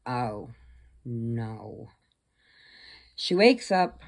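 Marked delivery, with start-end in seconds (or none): 0.1-1.9 low, soft sinking realization; retold dismay. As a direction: let the dread drop quietly. Retold realization of being drugged.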